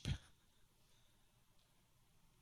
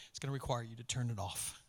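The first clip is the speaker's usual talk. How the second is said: ordinary talk, nothing marked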